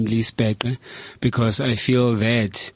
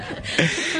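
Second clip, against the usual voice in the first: breathy laugh; 4 dB quieter than usual. Real, not acted. Laughing at the teasing.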